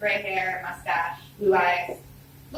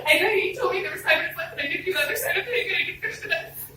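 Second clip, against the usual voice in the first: higher, fast, half-laughing sobbed rush; crying through tears; 10 semitones higher, faster than usual. Retold or imitated. Daughter explains the failed test to her dad, sobbing. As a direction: spill it all out through tears. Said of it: "I'm crying through my tears".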